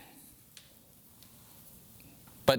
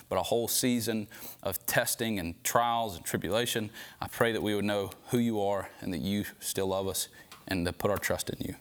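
lower, earnest praying voice; closing prayer; 5 semitones lower than usual. Real, not acted. Preacher praying for men facing seasons of testing, earnest prayer.

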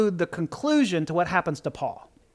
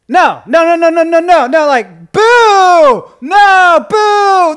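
loud, higher booing protest; mock booing; 7 semitones higher, 10 dB louder, slower than usual. Preacher booing the book's ending, loud mock protest. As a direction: boo it like a let-down crowd.

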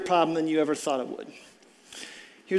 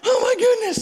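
exasperated shock; 3 dB louder than usual. Retold or imitated. Retold panic at wrong clinic.

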